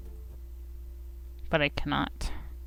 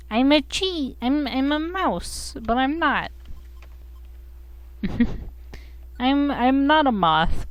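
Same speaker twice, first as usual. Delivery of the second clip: high, drawn-out character voice; earnest game character; 8 semitones higher than usual. Acted. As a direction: play a little game hero explaining.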